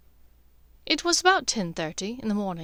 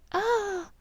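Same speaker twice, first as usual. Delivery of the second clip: flirt back though it makes you squirm; forced flirtatious; 11 semitones higher, 4 dB louder than usual. Acted. Draco as Narcissa, forcing a coy reply to Lucius. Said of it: "did his best to sound flirtatious".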